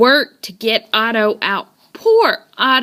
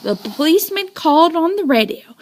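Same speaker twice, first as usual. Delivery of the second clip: plain reading voice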